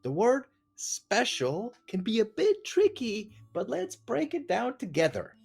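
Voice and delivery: high, sing-song encouraging teacher; sweet teacher voice. Pretend. Dad reads ChatGPT's advice to his daughter in a high sweet teacher voice. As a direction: read it like a sugary kindergarten teacher praising a child.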